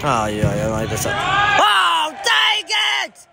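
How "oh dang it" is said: high, loud yelp of annoyance; frustrated shout. Real, not acted. Gamer yelling at the match on screen, frustrated shout. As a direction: yell out at the failed play. Player's attempt fails on screen.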